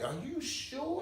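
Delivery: mock worried driver. Lightly voiced driver asking if he is sure. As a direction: imitate the driver's doubt. Driver doubting him, quoted.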